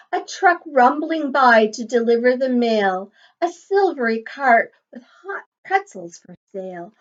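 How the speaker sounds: lilting, sing-song picture book read; reading to children. Acted. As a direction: read the rhyme aloud to a class. Reading rhyme to class.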